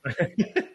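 bright amused laugh; quick embarrassed laugh; short laugh. Real, not acted. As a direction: laugh off the compliment.

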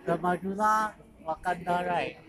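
ordinary talk, nothing marked

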